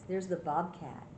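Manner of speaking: plain reading voice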